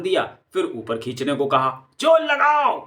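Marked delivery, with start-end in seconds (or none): 2.0-2.8 high loud strained call; straining shout while hauling rope; heave call. Acted. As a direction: shout to pull together. Thugs hauling the heavy sack.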